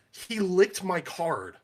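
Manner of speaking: high, loud and slow, outraged; indignant kid. Retold or imitated. Kid tattling to the players, slow and outraged. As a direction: drag it out like a wronged kid.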